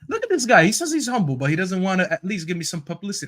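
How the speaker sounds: high-pitched indignant protest; worked-up, exasperated grievance; dramatic complaint. Real, not acted. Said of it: "You're being dramatic".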